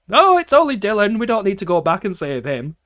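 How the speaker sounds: a mocking dismissive quote. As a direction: sneer it like a careless writer. Mocking game writers quote.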